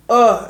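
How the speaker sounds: loud groan; mock groan as sedation hits. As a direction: let out a heavy ugh.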